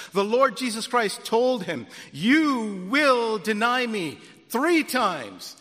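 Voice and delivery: loud, slow, stress on three; emphatic warning. Acted. Preacher driving home Jesus' warning, stress on three. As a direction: hammer the word three.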